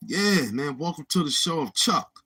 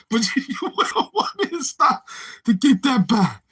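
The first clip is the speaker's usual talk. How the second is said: higher words squeezed through laughter; real laughing strain in the voice; helpless laughing; 6 semitones higher than usual. Real, not acted. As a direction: push the sentence out while cracking up. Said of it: "That was the Chuck Norris laugh".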